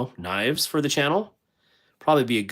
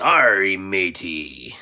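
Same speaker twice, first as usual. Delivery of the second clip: gruff playful pirate growl; 4 semitones lower, much slower than usual. Pretend. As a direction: ham up a pirate call. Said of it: "It will make me talk like a pirate".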